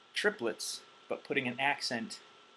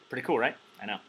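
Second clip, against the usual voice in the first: ordinary talk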